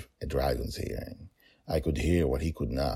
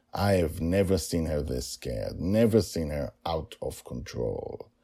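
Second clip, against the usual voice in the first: plain reading voice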